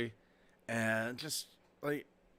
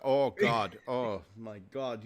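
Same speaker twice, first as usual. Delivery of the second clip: put-on Minnesota accent; 5 semitones lower, slower than usual. Showing how Minnesota talks, overpronounced O accent. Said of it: "like, the overpronounced O".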